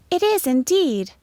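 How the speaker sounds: high, lilting schoolteacher reply; high-pitched teacher's answer; teacher agreeing. A narrator's reading. Teacher answers pupil in story.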